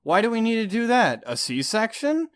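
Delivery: mock starship-captain impression; William Shatner. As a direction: question it hammily, stretching the last word. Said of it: "William Shatner is like".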